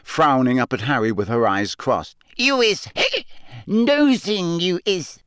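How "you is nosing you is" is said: very high, slow, hiccup-broken squeak; defiant tipsy elf refusing to tell; mutinous drunk elf. A narrator's reading. Winky defiantly refuses Harry, hiccuping.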